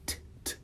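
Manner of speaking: "t, t," whispered puffs of air; soft aspirated t. Shown as a demonstration. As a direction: breathe out a soft "t". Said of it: "suavizar o seu T pra um t".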